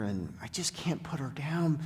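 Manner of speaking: breathy whisper of a heartbroken vet. Retold or imitated. Vet whispering to the sanctuary owner about the old dog. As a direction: drop to a hushed whisper as if confessing. Vet retelling a dog's fate.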